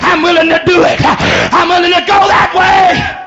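driving revival cadence, phrase by phrase; full-force preaching. Real, not acted. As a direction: press the congregation with shouted declarations.

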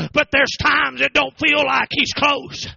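building to a shouted sermon peak; preaching at full force. Acted. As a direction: hammer it home like a revival preacher. Preaching climax on feeling God.

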